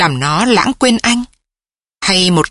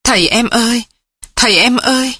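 higher, wobbling wife's cry, half sobbing; whimpering call; 4 semitones higher, faster than usual. A narrator's reading. Wife whimpering over her dying husband, higher. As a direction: call his name as if on the edge of tears. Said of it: "mếu máo".

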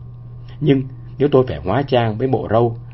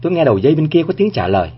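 ordinary delivery, nothing marked

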